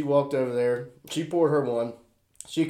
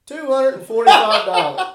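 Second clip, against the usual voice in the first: incredulous; 10 semitones higher, 8 dB louder than usual. Real, not acted. Telling the shocking bar bill.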